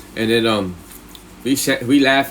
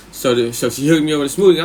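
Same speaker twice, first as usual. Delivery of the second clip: ordinary delivery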